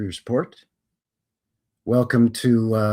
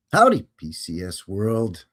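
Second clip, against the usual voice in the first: ordinary talk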